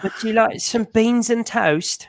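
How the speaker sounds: high, slow mock English accent; hammed-up British offer, drawn out; put-on British accent. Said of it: "I can't stop speaking like this".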